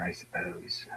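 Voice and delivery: whispered afterthought; soft whispered shrug. Real, not acted. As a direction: let it fall to a whisper. Trailing aside to himself.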